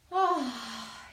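long sighing breath out; audible exhale. Shown as a demonstration. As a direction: let the air go with an ah. Breathing exercise, exhale sound. (exhalación con suspiro)

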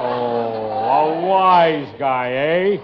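sneering gangster. Acted.

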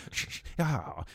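soft hushed shush ending on a sigh; a creepy soothing hush; Joker shushing. Retold or imitated. Imitating the Joker soothing the captive, soft hushed. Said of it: "he's like".

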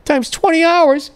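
put-on a squeaky, overjoyed dumb kid counting the money; naive kid. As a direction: play his dumb nineteen-year-old self thrilled at fifty cents. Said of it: "And I was like".

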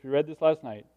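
ordinary talk

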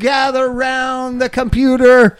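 booming high announcer voice; ringmaster. Pretend. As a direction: call the crowd in like a ringmaster. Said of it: "It's an old-time radio hour".

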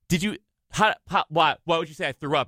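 retold defensive questioning; flustered denial. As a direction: stumble over the question as if caught out. Acting out his flustered denial.

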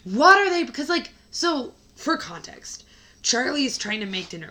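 ordinary talk, nothing marked

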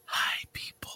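breathy unvoiced whisper into the mic. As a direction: whisper it like a secret hello. Whispered greeting before show starts.

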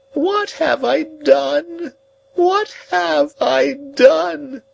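high, very slow wail; despairing cry. A narrator's reading. Old woman on the ground lamenting, slow high wailing. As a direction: drag out each word in despair. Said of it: "she cried despairingly".

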